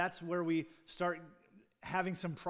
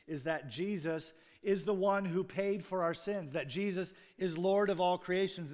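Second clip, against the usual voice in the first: preaching at full force; 5 semitones higher, 7 dB louder than usual. Acted. Preaching climax on the gospel.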